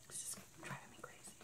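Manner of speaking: unvoiced whispered aside. Real, not acted. Whispering to viewers about the leaves, hushed. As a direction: breathe it out, no voice.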